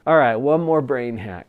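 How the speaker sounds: usual speaking voice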